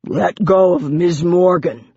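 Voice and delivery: gravelly gargoyle giving an order; deep, gravelly voice. A narrator's reading. Gargoyle to demon gripping Morgan, gravelly command. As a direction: growl it as a protective gargoyle. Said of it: "came a deep, gravelly voice".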